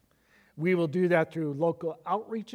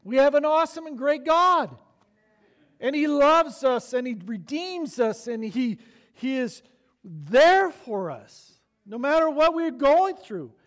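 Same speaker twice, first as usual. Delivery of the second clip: loud, high, drawn-out preaching; full-force preaching; 9 semitones higher, 6 dB louder than usual. Acted. Pastor preaching to the church at full force. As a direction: preach it out, ringing and slow.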